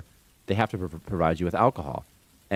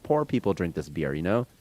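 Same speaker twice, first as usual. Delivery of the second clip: usual speaking voice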